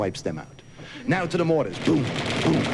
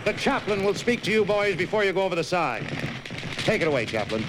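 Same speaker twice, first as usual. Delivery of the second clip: loudspeaker announcement; 7 semitones higher than usual. Retold or imitated.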